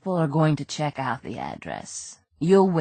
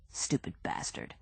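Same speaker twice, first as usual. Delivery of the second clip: muttered insult; 13 dB quieter than usual. Acted.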